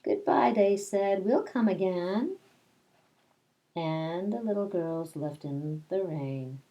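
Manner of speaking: put-on reading a picture book aloud to children; lilting read-aloud, rhyme by rhyme; storytelling voice. Teacher reading Madeline to her class, storytelling voice.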